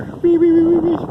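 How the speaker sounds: high, loud mock beeping; playful mimic of warning beeps; imitated drone beeps. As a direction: beep like the drone's alarm.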